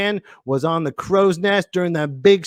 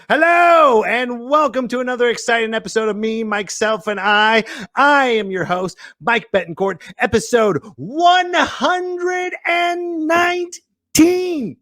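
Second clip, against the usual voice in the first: high, booming mock-announcer intro; show announcer; 9 semitones higher than usual. Host welcoming viewers to episode, hyped announcer. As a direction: hype the episode number, stretch it high.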